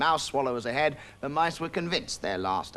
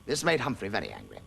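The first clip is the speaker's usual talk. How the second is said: plain reading voice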